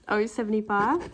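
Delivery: usual speaking voice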